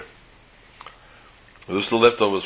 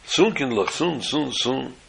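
begging; 3 semitones higher, 4 dB quieter, slower than usual. Retold or imitated. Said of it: "they start begging the fa-, father".

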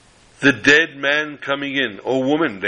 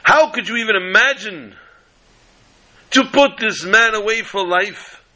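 attorney pleading, raised and indignant; impassioned courtroom orator; 7 semitones higher than usual. Acted. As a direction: hammer home the outrage like a showman lawyer.

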